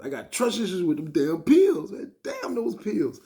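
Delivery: loud, high-pitched exasperated rant. Real, not acted. Storyteller blames the pills that failed him, exasperated. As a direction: curse the pills in comic frustration.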